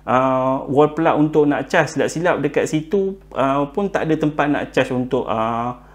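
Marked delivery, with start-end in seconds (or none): none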